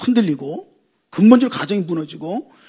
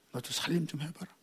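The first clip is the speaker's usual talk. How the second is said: barely voiced, much softer wifely coaxing; softer still; 6 semitones lower, 18 dB quieter than usual. Wife repeating her gentle reply, near whisper. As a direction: let it fall almost to a breath. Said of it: "여자에다가 니은을 해가지고".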